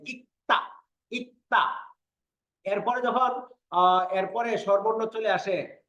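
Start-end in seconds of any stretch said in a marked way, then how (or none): 0.1-1.9 "ইক্তা" with the k sounded and the l silent, pitched up; pronunciation demo. Shown as a demonstration. Teacher shows the silent l, demonstrating "ইক্তা" as ik-ta. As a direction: say "ইক্তা" as ik-ta, higher, each take set apart. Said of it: "গিউক উচ্চারণ হয়েছে, লিউল উচ্চারণ হয় নাই".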